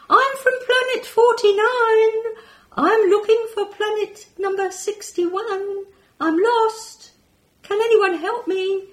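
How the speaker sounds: high, loud, lost little alien voice. Acted. Lost alien calling through the ear for help, high pleading voice. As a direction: plead like a lost space creature.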